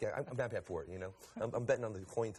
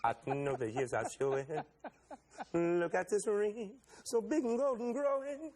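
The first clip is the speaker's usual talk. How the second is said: slow, wavering high croon; 5 semitones higher, slower than usual. Retold or imitated. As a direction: stretch every word like a soul singer. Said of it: "[name] ... he goes".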